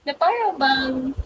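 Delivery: usual speaking voice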